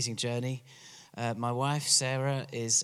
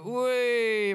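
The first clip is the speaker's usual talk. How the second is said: a higher, rousing "Way!" the English way; English crowd cheer; 11 semitones higher than usual. Shown as a demonstration. Preacher showing how the English cheer, higher rousing call. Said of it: "just go".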